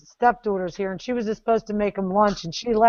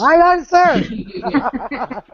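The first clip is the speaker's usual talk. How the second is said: laughing, drawn-out "aye, aye, sir", higher and louder; mock recruit; 7 semitones higher, 6 dB louder, much slower than usual. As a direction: answer like a cadet through a laugh. Student plays recruit answering sergeant.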